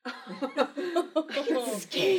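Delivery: high, loud protest through laughter; laughing protest. Real, not acted. Mother protests her son's teasing about skiing, loud and laughing. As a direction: insist loudly while cracking up.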